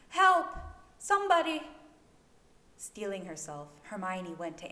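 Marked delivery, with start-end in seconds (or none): 0.1-1.6 screamed high and loud, panicked; desperate cry for help. A narrator's reading. Woman in labor screams for help in the snow. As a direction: call out for help as if dying. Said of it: "a woman's cries, feeble and desperate".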